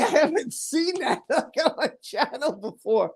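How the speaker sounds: real laughter breaking up the words; laughing in disbelief. Real, not acted. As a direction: talk through amazed laughter.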